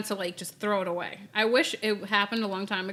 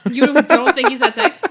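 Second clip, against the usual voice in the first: loud, higher, incredulous question; surprised disbelief; 4 semitones higher, 11 dB louder than usual. Real, not acted. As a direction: blurt it out in disbelief. Shocked at host's claim.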